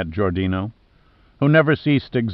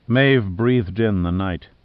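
usual speaking voice

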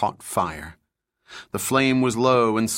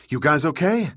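higher, called across to the team; calling out; 5 semitones higher than usual. A narrator's reading. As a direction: check on everyone, calling out. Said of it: "she called to her people".

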